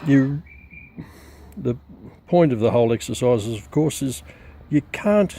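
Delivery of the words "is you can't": usual speaking voice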